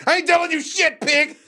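hammed-up rebel yelling at police; mock outlaw yells at the cop; mock defiant shout. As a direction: shout it high and loud at the cop. Acted defiance at cops.

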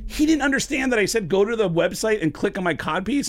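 high-pitched, exasperated disbelief; incredulous protest. Retold or imitated. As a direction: protest that the joke was obvious. Incredulous at prank being believed.

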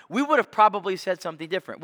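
ordinary talk

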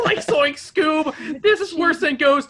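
mock cartoon Shaggy panic; Shaggy shout.

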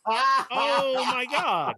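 high cry of laughter, then a slow loud oh my God; laughing despair. Real, not acted. Telling friends about the locked mailbox, high laughing wail. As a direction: laugh it out in disbelief.

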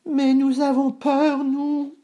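very high, slow plaintive goat voice; high-pitched fearful bleat of protest; frightened goat brothers. Acted. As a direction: whine high like scared little goats. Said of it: "dirent ses frères en chœur".

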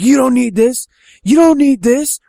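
high, loud mock yelling; acted high-pitched fury; raging father. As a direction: yell it at the boy, repeating harder. Father raging while burning pants.

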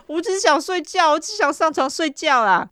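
high-pitched hammed-up whine; mock whiny pleading. Suspect begs the detective for sleep, mock whiny pleading. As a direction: imitate a worn-down suspect begging, voice way up.

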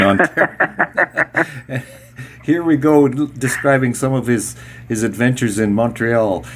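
ordinary delivery

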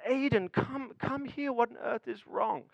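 hushed, tender father at bedtime; tender dad. Retold or imitated. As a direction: gently call a distraught son over. Father coaxing upset son.

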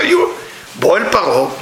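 ordinary talk, nothing marked

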